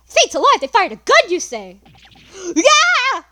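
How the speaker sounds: high, loud flustered cry; panicked shout. Acted. Witness panicking under cross-examination, loud and high. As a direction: panic at the accusation.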